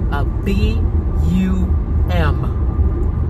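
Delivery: A B U M spelled letter by letter. Shown as a demonstration. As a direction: spell A B U M out slowly. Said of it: "spelling it out".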